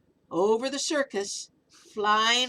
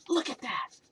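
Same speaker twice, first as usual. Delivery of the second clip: hushed amazed whisper; 2 semitones higher than usual. Real, not acted. Excited whisper to listener.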